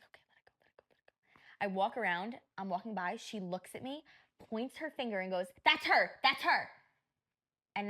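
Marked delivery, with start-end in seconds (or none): 0.0-1.6 barely audible whisper to oneself; whispered self-talk. Retold or imitated. Whispered self-soothing in retold story. 5.6-6.7 louder, higher imitation of someone pointing; mock accusing call-out. Acts out woman pointing and accusing.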